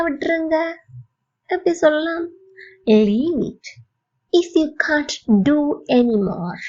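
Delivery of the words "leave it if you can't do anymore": slow, evenly spaced teaching delivery; slow model phrase. Shown as a demonstration. Teacher to English learners, slow model phrase. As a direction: say it slowly for learners to copy.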